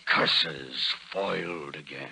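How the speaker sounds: foiled villain. Acted. Porter Hall admits defeat to Roy, lightly hammed villain. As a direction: grumble the defeat like a stage villain. Villain thwarted, classic line.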